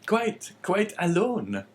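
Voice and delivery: slow, high-pitched innkeeper voice; innkeeper's reply. A narrator's reading. Man replying to his guest, high innkeeper voice. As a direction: echo it slowly in a high innkeeper voice. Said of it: "replied the man".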